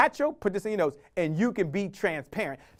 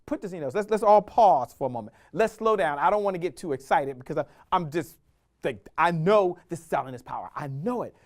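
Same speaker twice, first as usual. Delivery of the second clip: ordinary delivery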